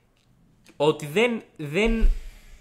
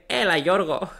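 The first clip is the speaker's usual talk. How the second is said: lightly voiced teacher, a bit higher and louder; a teacher's phone greeting, lightly put on; imitated teacher; 6 semitones higher, 5 dB louder, slower than usual. Teacher rings her sleepy student, lightly voiced teacher. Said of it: "με παίρνει τηλέφωνο η καθηγήτριά μου".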